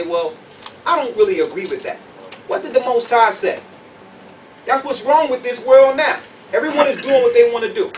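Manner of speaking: ordinary talk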